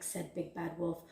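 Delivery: ordinary delivery, nothing marked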